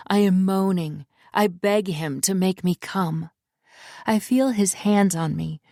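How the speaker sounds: plain reading voice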